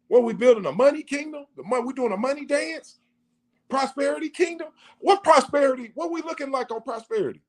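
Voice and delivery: high-pitched, incredulous questioning; incredulous challenge. Retold or imitated. Men questioning what church is building, high incredulous challenge.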